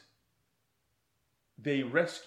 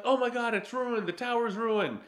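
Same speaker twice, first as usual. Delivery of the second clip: mock dismay; 8 semitones higher than usual. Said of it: "And they're like".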